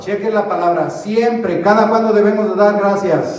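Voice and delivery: slow, loud, drawn-out lines for the church to repeat; call and response. Acted. Pastor leading the congregation to repeat the verse, loud and slow. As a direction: lead the congregation word by word.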